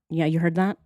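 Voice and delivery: normal talking voice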